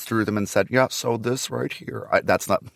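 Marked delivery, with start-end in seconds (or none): 0.7-2.1 Margaret Atwood impression. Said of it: "really horrible Margaret Atwood impression".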